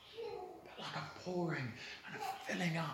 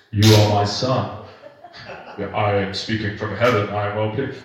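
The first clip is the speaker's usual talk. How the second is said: booming voice of God; 8 semitones lower, 11 dB louder than usual. Pretend. Mocking how God might have sounded, booming. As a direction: boom it down from heaven. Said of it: "I don't imagine that's how God said it".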